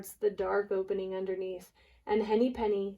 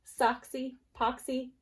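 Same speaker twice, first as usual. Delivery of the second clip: usual speaking voice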